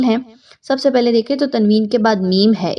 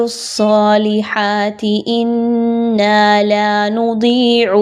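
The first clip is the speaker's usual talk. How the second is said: slow melodic tajweed chant; Quranic recitation; much slower than usual. Shown as a demonstration. Teacher reciting the next verse, melodic chant. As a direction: chant each word slowly and evenly.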